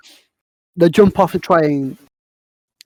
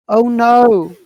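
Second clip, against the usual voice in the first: mock alarm; 7 semitones higher, 4 dB louder than usual. Acted alarm at evil guardians.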